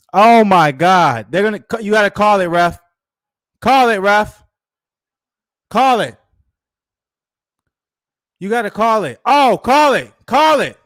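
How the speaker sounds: loud, high urgent shouts; yelling at ref. Real, not acted. Fan shouting at the referee to stop the fight, loud.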